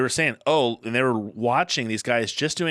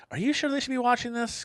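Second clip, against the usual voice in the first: quoting wife; 14 semitones higher than usual. Retold or imitated. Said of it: "my love's like".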